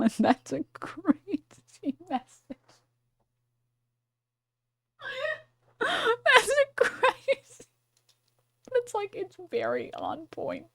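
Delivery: high, squeaky through laughter; words squeezed out between laughs; laughing hard. Real, not acted. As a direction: crack up while trying to talk. Cracking up at a chat message.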